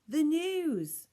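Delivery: high, loud shout over the noise; yell. A narrator's reading. Girl shouting over the rubbish truck to the crowd. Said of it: "she yelled".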